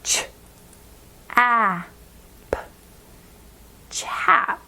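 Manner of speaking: "ch", "a", "p" one by one, then "chap" blended; sounding out chap. Shown as a demonstration. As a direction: segment then blend the new word. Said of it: "will become".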